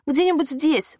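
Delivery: a touch of nauseous panic in a croak; hoarse plea. A narrator's reading. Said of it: "прохрипела Лена, борясь с тошнотой и ужасом".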